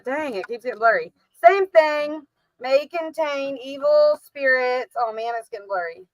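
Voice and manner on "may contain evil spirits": mock warning label read. Shown as a demonstration. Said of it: "It says".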